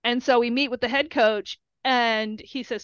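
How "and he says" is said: ordinary talk, nothing marked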